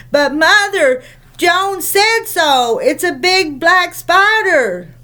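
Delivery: put-on high, slow, insistent child's plea; squealing child. Anne begging her mother to believe the spider story. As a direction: jump about and squeal it.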